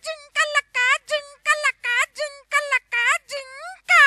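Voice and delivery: high actress voice. Acted. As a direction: squeal it like a film actress.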